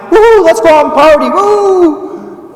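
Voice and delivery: high, loud whooping party voice; hammed-up reveller calling everyone out; party animal. Preacher mocking a false voice as a whooping partier. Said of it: "Is the Father's voice like".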